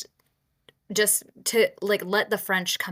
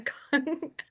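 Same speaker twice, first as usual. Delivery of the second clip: bursts out laughing at herself; nervous giggle; embarrassed laugh; 7 semitones higher than usual. Real, not acted. Laughing at mangled name.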